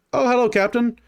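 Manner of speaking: sudden sheepish hello; surprised guard. Acted. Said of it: "and you hear".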